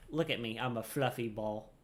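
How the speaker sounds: ordinary delivery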